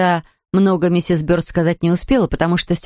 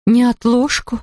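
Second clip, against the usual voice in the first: alarmed question; 5 semitones higher, much slower than usual. A narrator's reading. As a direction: echo the word in alarm. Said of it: "бледнее воскликнула". (испуганный переспрос)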